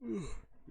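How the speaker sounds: tired sigh. Real, not acted. Tired of talking so much.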